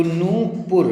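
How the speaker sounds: नूपुर with a long noo and short pur; correct form. Shown as a demonstration. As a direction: stretch the first vowel of नूपुर, then a short u. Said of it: "ना पे बड़ू की मात्रा".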